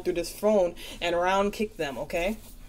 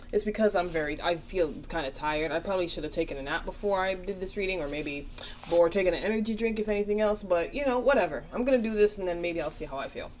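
ordinary delivery

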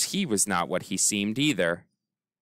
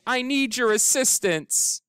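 shouting; 13 semitones higher than usual. Acted. Boy shouting to his aunt for assistance. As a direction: call out for help in a lifted voice. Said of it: "he shouted".

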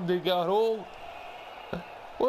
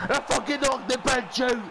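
loud, high victory shout; triumphant boast; 7 semitones higher, 3 dB louder than usual. Real, not acted. Gamer boasting to viewers after winning the fight, triumphant. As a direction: crow over the knockout, voice pitched up.

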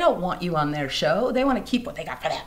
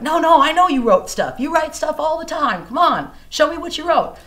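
much louder, higher urging voice; eager friend; 10 semitones higher, 10 dB louder than usual. Retold or imitated. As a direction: push her to grab her guitar. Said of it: "he's like".

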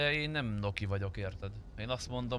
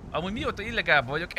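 higher, louder and fast; eager, fast, raised voice; excited; 7 semitones higher, 4 dB louder, faster than usual. Real, not acted. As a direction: rush the words out in excitement. Excitedly telling chat a story.

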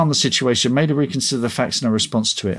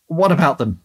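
blank, higher-pitched query; 5 semitones higher than usual. A narrator's reading. Shop man to an impatient customer, blankly. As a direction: ask it blankly, as if missing the point. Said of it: "the other Mark said blankly".

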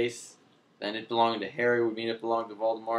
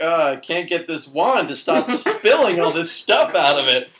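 mock flustered henchman; 5 semitones higher, 8 dB louder than usual. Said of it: "the one henchman was like".

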